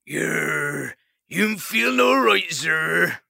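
rustic west country mole; west country accent. Acted. Mole answers.

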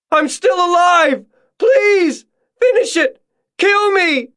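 extremely high, crying screams; agonized screaming. A narrator's reading. June screams to be finished off, agonized high shrieks. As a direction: scream and beg for an end. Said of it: "the screams getting louder".

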